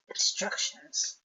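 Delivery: hushed secretive whisper. Acted. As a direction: breathe the word conspiratorially. Drops to a secretive aside.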